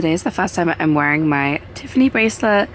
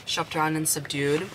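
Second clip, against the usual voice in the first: usual speaking voice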